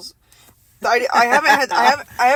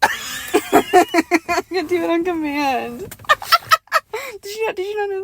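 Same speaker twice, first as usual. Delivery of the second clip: slow, laugh-filled higher words; real laughter; 8 semitones higher, much slower than usual. Real, not acted. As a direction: push the words through laughter. Laughing while speaking.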